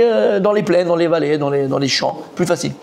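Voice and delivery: ordinary delivery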